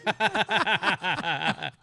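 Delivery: usual speaking voice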